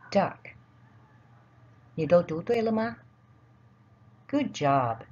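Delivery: ordinary delivery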